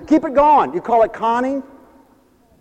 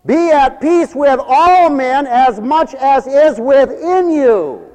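slow, high, loud scripture proclamation; preached Bible verse; preaching; 10 semitones higher, 7 dB louder, much slower than usual. Shown as a demonstration. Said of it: "the Bible says".

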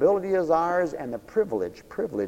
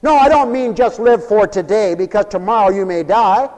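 loud, raised, urgent sermon voice; full-force preaching; 11 semitones higher, 13 dB louder than usual. Acted. Preacher urging the congregation about today, full-force preaching. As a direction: drive each point home at full volume.

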